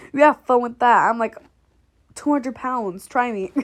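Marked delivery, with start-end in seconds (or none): none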